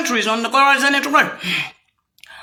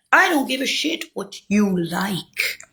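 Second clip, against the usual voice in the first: cold slow retort; 5 semitones lower, 6 dB quieter, slower than usual. Real, not acted. Retort to quoted critic.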